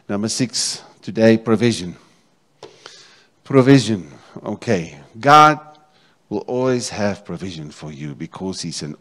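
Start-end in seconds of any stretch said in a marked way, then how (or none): 3.4-4.1 emphatic. Acted. Preacher repeating key word. 5.2-5.6 exclaimed. Acted. Preacher exclaiming name.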